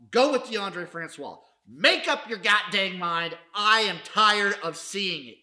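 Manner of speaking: exasperated rant. Real, not acted. Host fed up with the team's quarterback switching, loud. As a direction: slam the team for flip-flopping. Ranting at coaching changes.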